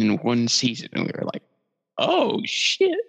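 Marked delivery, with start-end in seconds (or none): 2.0-3.0 high-pitched retold astonishment; amazed reaction. Fans realizing how good the season was, amazed. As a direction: let it jump way up, breaking into laughter.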